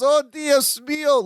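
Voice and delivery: high, slow mock yell, no added volume. Gina in labor as the car brakes, mock yell. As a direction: imitate a woman crying out, pitched high. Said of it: "Gina yells".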